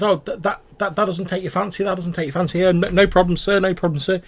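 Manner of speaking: high, loud, wheedling sales pitch; oil up the customer; pushy little merchant voice; fawning goblin salesman. Acted. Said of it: "And he says".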